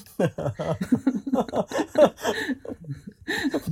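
long high rising laugh; drawn-out high giggle. Real, not acted. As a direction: laugh high at the modest answer. Laughing at modest answer.

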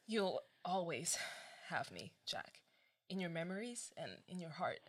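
soft, slow farewell; faint last words. Acted. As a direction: let the voice fade out.

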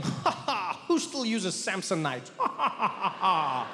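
mocking laugh at other travellers' bags; hammed-up smug cackle. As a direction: laugh down at the Samsonite crowd.

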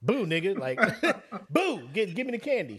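laughing mock demand. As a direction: demand candy while laughing. Acted kid, laughing.

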